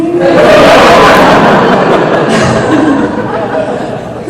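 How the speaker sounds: high, long, breathy laugh; big laugh. Real, not acted. Actor laughs at the fan's remark, high laughter. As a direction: burst out laughing at yourself. After mock outrage.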